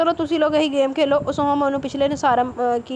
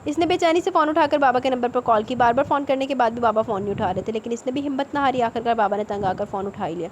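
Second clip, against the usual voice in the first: ordinary delivery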